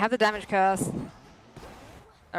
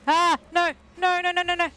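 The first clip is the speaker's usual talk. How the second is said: high, loud rising panic; real panic, no no no; panicked cries; 10 semitones higher, 4 dB louder than usual. Real, not acted. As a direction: cry out as the hits land. Panicked cries under attack.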